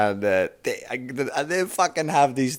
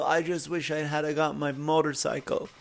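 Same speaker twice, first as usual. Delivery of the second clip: put-on frail old man; 3 semitones higher, 10 dB quieter than usual. Dying old man's regret.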